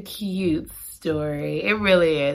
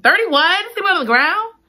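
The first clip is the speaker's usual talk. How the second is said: incredulous; 9 semitones higher, 3 dB louder than usual. Real, not acted. Outraged at sleeping on ground.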